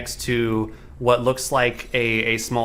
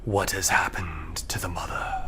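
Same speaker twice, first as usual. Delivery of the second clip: eerie low whisper; whispering tree voice; 4 semitones lower, 6 dB quieter than usual. Acted. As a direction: breathe it like a hungry tree.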